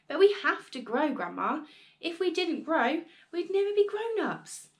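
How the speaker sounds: put-on a boy insisting, rising in pitch; pleading boy. A narrator's reading.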